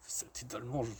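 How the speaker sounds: whispered aside. Acted. Host whispering an insult about the German. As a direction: hiss it under your breath.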